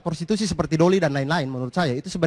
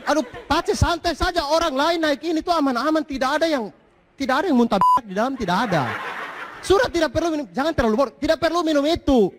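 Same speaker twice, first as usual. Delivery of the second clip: higher, pleading and exasperated; 8 semitones higher than usual. Retold or imitated. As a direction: beg him to calm down. Pleading with friend, comic.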